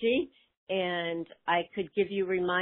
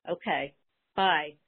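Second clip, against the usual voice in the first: ordinary talk, nothing marked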